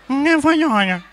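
high, loud nasal reply; hammed-up honking voice; nasal speech impediment. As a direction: blurt it loudly through the nose. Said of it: "Agora vai falar fanhoso".

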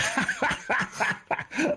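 high, breathless cackle; imitated laughing fit. Director's reaction to the monster voice, imitated high laughter. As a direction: mimic someone cracking up helplessly. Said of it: "And he just went".

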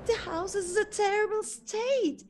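high, loud shocked gasps at the ruins. Acted. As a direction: gasp in amazement at the wreck. Shocked at the ruined house.

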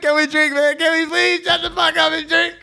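exasperated, to friends rambling on. Retold or imitated. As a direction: beg them to just drink. Exasperated, louder.

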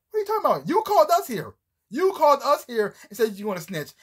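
high, disbelieving questions from the agent; incredulous FBI agent. Retold or imitated. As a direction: play the baffled agent, pitch jumping up. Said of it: "He said".

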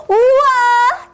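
high gasp and drawn-out wow; boy thrilled at his new pillow; delighted gasp. Acted. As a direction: gasp with joy at a present. Child delighted by gift.

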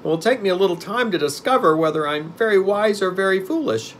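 high, loud put-on creature voice; pumpkinhead. A narrator's reading. Newborn pumpkin man pondering his own wisdom, high and loud. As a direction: ponder aloud in a high, earnest pitch. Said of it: "replied Jack".

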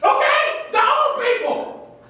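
fired up and indignant; ranting. Acted. Coach ranting.

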